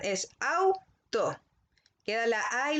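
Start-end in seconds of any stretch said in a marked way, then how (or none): 0.4-1.4 auto said slowly as au-to, syllables apart; split into syllables. Shown as a demonstration. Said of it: "si ustedes la separan".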